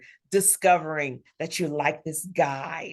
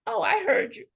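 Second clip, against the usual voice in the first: high, knowing voice of love; personified love. Acted. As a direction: lift it high, as love answering.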